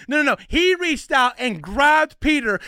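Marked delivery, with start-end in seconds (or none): none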